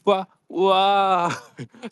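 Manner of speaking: high, very slow tiny whimper; a drawn-out squeak of helplessness; Chiikawa whimper. Retold or imitated. Helpless person can only squeak, high slow whimper. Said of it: "無力な人間って大体みんなそんなもんだ".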